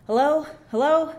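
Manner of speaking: calling into the phone for an answer; calling hello. Acted. Mother answering the phone, calling hello.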